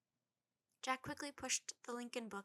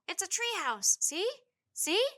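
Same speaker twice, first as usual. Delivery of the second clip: excited shouting; 10 semitones higher, 12 dB louder than usual. A narrator's reading. Boy excitedly pointing out treehouse.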